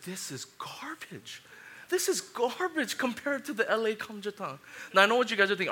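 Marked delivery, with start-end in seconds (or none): 0.0-1.4 slow, breathy disgusted whisper. Retold or imitated. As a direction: whisper the verdict in disbelief. Spitting out disappointing kamjatang.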